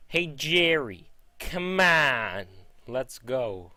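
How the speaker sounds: loud, high drawn-out call; yelling. Acted. Elaine yelling to Jerry in the bedroom, yelled call. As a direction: call out impatiently, stretching the words. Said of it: "yelling to Jerry in the bedroom".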